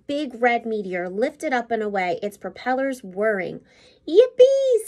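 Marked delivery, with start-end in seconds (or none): 4.1-4.9 much higher, louder joyful shout; Billy cheering the plane's launch; yippee cheer. A narrator's reading. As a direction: cheer it out like a thrilled kid. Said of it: "said Billy".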